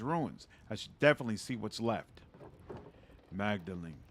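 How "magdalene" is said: put-on old father voice. Father figure in game dialogue.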